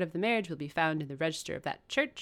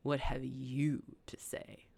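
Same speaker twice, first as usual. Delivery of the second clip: cold, slow whisper; quiet contempt; 2 semitones lower, 6 dB quieter, slower than usual. Acted. Rochester coldly asks Mason, slow contemptuous whisper. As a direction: hush it out with contempt. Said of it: "He only asked".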